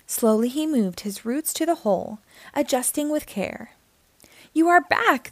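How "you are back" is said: high, louder welcoming call; glad cry. A narrator's reading. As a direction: call out in delight. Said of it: "the other trees cried".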